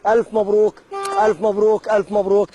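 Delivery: loud, high, jubilant announcement; booming prize announcer. Acted. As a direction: boom it out like a game show host. Host announces prize win loudly. (مذيع جوائز بصوت جهوري)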